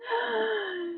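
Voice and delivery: long high intake of breath; soft drawn-out gasp; held-breath gasp. Acted. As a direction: suck in air nervously. Holds breath in the story.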